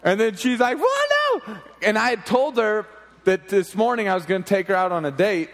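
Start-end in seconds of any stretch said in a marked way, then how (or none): 0.8-1.6 very high mock tantrum squeal; bratty child imitation. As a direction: imitate a six-year-old throwing attitude. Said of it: "freaking out and throwing all sorts of attitude".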